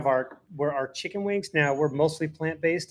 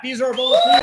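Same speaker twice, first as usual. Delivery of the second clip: much higher, loud call-out; excited shout; 6 dB louder, much faster than usual. Real, not acted. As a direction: call it out to the crowd. Calling out to volunteers in back.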